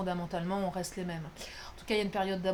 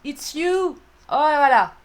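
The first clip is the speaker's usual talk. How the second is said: louder and higher, thrilled; excited reveal; 4 semitones higher, 13 dB louder than usual. Real, not acted. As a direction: announce it like a happy surprise. Joyful English card reveal. (annonce joyeuse, plus forte et plus aiguë)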